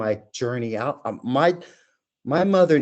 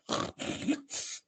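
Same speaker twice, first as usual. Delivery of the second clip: imitated sniffing; 11 dB quieter than usual. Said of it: "they make this noise like".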